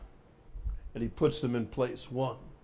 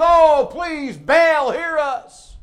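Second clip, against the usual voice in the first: loud, high hammed-up begging; crying out; 13 semitones higher, 11 dB louder, much slower than usual. Preacher as bleeding prophets begging Baal, crying out. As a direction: wail the plea, dropping off at the end.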